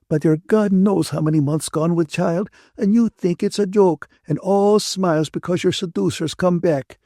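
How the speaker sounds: high-pitched, indignant reproach; upset Ferguson, near tears. A narrator's reading. Ferguson berates Catherine just before she cries, upset and indignant. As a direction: build a reproach toward tears.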